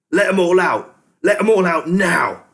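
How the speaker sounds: high, loud, raging rat yell; furious shouting. A narrator's reading. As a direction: bellow it, shaking with rage. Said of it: "he shouted up to Keith".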